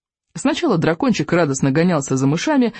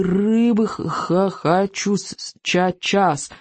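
stammering little dragon; 3 semitones higher, much slower than usual. Acted. Hungry dragon stammers for fish.

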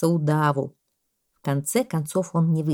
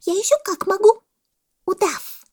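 very high, emphatic monkey voice; solemnly announcing monkey; 6 dB louder than usual. A narrator's reading. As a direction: proclaim it proudly as the monkey. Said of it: "торжественно объявила мартышка". (мартышка, торжественно и очень высоко)

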